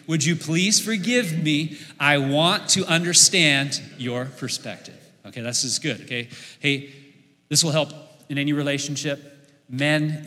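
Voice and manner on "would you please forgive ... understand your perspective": slow, measured, leading everyone together; slow group recitation. Shown as a demonstration. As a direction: chant it slowly with the crowd once more. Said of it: "Let's do it again".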